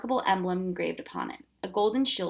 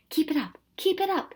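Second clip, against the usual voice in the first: encouraging; 9 semitones higher than usual. A narrator's reading. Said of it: "he encouraged".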